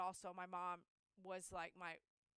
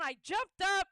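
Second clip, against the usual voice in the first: ordinary talk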